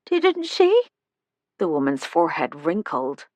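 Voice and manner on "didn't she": put-on thin, high, slow frail old voice; a tiny old woman calling from the doorway; high-pitched old woman. A narrator's reading. Old resident greets the visitors, high-pitched and slow.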